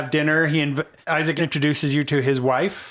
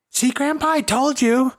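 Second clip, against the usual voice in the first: put-on high excited child voice; squeaky kid telling grandpa; little kid; 12 semitones higher than usual.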